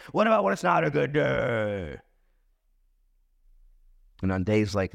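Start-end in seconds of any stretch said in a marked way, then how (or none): 0.1-2.0 hammed-up dismay. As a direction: stretch the last word into a playful groan. Contrast after cheering good days.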